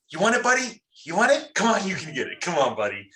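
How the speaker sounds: high, sing-song teasing a buddy; teasing taunt. Acted. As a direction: dangle it in front of him, mocking. Teasing a friend with his nose.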